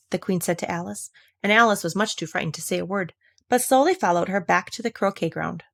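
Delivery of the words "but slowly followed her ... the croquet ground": ordinary delivery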